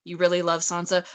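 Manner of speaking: usual speaking voice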